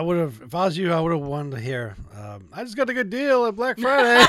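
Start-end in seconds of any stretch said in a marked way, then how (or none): 2.5-4.2 excited cheer. Pretend. Joking hoped-for cheerful line.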